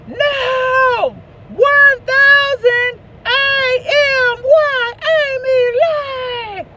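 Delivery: high, loud, drawn-out spelling shout; hollering at Siri. Retold or imitated. Mother shouting her address at Siri, slow loud spelling. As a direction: yell each letter slowly at the phone. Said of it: "my mom is hollering at Siri".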